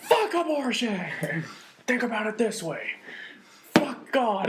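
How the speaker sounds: high raspy voice with a laugh; hammed-up old comic ranting slowly; raspy George Carlin. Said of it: "super raspy voice".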